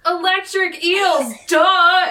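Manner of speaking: high, loud comic yell; retold yell. Cohost acts out Carlos answering Logan's question, comic yell. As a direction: yell it as an obvious comeback, "duh" loudest. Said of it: "And Carlos yells".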